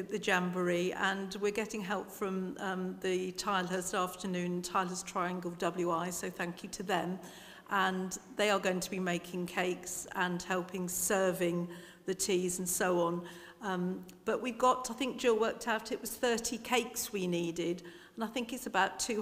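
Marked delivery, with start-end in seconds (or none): none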